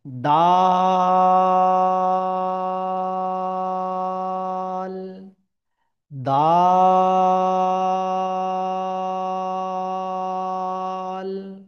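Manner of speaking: দাল drawn out very slowly, three counts; তিন আলিফ টেনে দাল; long dal. Shown as a demonstration. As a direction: stretch দাল to three alifs. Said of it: "তিন আলিফ বরাবর টেনে".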